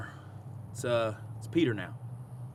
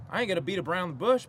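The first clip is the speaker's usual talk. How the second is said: ordinary talk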